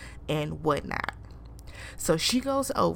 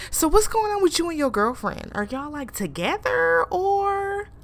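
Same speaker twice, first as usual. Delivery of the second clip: mocking Evanita; 9 semitones higher, 3 dB louder than usual. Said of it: "Evanita was like".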